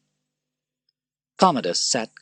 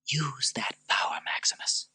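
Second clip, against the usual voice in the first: high, soft whispered plea; urgent whisper; 8 semitones higher, 6 dB quieter than usual. Acted. Lucilla whispering to Maximus in prison. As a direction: whisper it like a secret.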